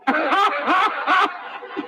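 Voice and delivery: a high airy laugh at his own joke; high breathy laugh. Real, not acted. Laughing after pork joke.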